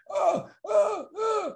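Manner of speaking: very high strained gasps, acted hurt; fake gasping. On all fours, boy fakes gasping at the bullies. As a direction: ham up being unable to breathe. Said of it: "on all fours going".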